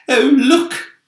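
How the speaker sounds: loud high excited call; shout. Acted. Ladybird spotting the arch, loud high excited shout. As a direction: cry out pointing. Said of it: "shouted the ladybird".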